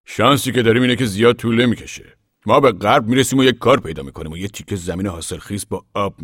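a proud father of the family speaking out; Papa voiced. Papa addressing the gathered men, loud and earnest.

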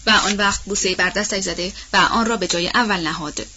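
plain reading voice

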